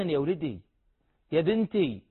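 tender address. Shown as a demonstration. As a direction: call your son and daughter gently. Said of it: "قل له دائما".

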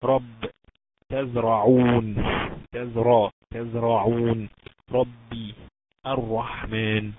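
slow tajweed demo of الرحمن and تزرعون with a full, heavy R. Shown as a demonstration. Teacher showing students when ra is heavy, heavy ra. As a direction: stretch each example, thickening the ر before an "a". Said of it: "لو كانت الراء مفتوحة تفخم".

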